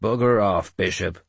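mild, curt snarl. A narrator's reading. Finan dismisses the pestering bishop, snarling. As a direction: send him off with a growl. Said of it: "Finan snarled".

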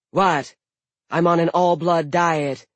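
loud, high scowling retort; defensive. Acted. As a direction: snap back defensively. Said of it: "scowled defensively".

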